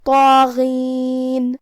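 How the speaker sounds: "طاغین" chanted very slowly with heavy first consonant, alif and ghain; heavy-voiced tafkheem. Shown as a demonstration. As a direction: fill the mouth on "طاغین" and draw it out. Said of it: "حرف الغین کو بھی بھاری آواز میں پڑھنا ہے". ("طاغین" منہ پھلا کے بھاری آواز میں)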